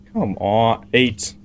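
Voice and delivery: ordinary talk, nothing marked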